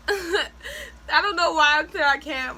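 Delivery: slow, laughing through the words. Real, not acted. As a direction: chuckle at yourself while saying it. Laughing at her own words.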